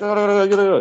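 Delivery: high-pitched gibberish mock of shouting police; rapid, high mock foreign shouting; imitating Thai shouting. Police shouting in Thai at a confused tourist, high mock shouting. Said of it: "they're just shouting in Thai".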